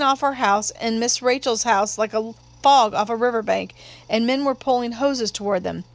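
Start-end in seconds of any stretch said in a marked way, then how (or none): none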